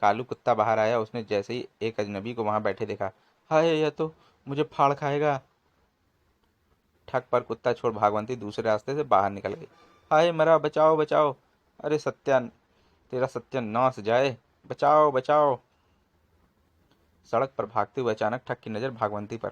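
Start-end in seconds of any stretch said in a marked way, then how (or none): 3.5-5.4 frightened cry. Acted. Thug panics at the dog. 10.1-15.6 loud frantic shouts of help; panicked cries for help. Acted. Thug chased by the dog screams for help, loud and frantic.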